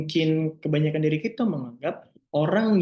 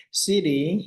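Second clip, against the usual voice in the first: Siti said higher with a lightly put-on Brooklyn accent; joking Brooklyn accent; 4 semitones higher than usual. Naming the story girl Siti in a joking Brooklyn accent. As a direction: toss the name off in a playful Brooklyn twang. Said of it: "Kita Siti dengan aksen Brooklyn".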